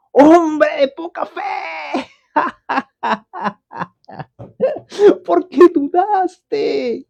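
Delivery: high, slow exclaiming with a laugh; with admiration. Shown as a demonstration. Preacher voicing Jesus, high and slow with admiring delight. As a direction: marvel warmly at him, not scolding. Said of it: "con signos de admiración".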